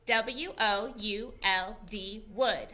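teacher leading a spelling game with the class; slow game spelling. Shown as a demonstration. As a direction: chant the spelling slowly like a game count. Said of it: "Here we go again".

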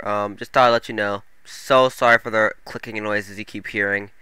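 usual speaking voice

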